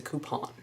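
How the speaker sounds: "coupon" with a nasal French ending; French-style pronunciation. Shown as a demonstration. Explaining the word is French, French-style pronunciation. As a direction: end "coupon" on a nasal -pon, N barely closed. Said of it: "It's a French word".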